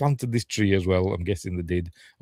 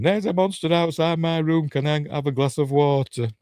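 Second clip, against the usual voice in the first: high-pitched mock child voice; little girl; 7 semitones higher than usual. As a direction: imitate a small child asking, pitched right up. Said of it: "she's there looking at him".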